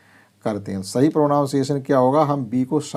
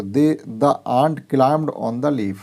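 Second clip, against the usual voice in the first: reads the English sentence very slowly, word by word; slow clear reading; much slower than usual. Shown as a demonstration. Teacher reading an example sentence to pupils, very slow. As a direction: spell it out slowly for the class. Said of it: "correct pronunciation से पढ़ेंगे".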